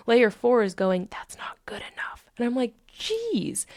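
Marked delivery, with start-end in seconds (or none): 1.1-2.3 hushed, whispered jab; under-the-breath criticism; whispered inner critic. Acted. Inner critic layer voiced in retelling. 2.9-3.7 high-pitched mock exasperation; squeaked-up little exclamation; exasperated reaction. Acted reaction to the inner critic.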